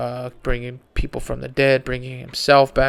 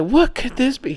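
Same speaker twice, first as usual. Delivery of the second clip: amazed wonder; 10 semitones higher than usual. Retold or imitated. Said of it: "And I was amazed".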